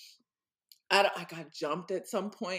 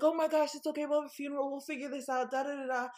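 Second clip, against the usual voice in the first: consoling friend; 8 semitones higher, faster than usual. Retold or imitated. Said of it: "she's like".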